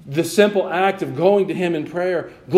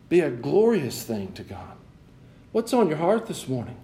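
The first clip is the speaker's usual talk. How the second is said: ordinary talk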